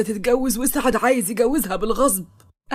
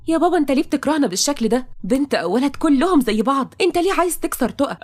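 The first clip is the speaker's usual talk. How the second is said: higher, fast pleading outcry; desperate pleading; 5 semitones higher than usual. Acted. Daughter begging her father to stop breaking Taqa. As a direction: beg him to stop, voice climbing.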